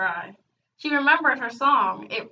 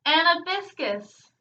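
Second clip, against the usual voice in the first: higher-pitched shout across an audience; shouted call; 7 semitones higher, 4 dB louder than usual. A narrator's reading. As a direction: call out the name, raised voice. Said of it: "Trouble shouted".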